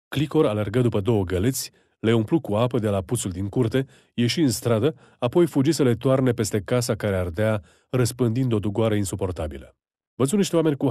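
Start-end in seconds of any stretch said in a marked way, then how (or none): none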